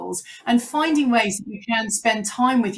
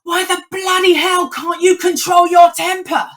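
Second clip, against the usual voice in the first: put-on higher, louder furious yell at the kids; exasperated dad shouting; retold shout; 10 semitones higher, 9 dB louder than usual. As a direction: blow up at them, full volume. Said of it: "he said".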